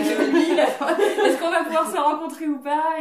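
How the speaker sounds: high and loud, laughing through the question; giggling as she plays the lost dater; laughing mock confusion. Woman laughing at her own dating app confusion.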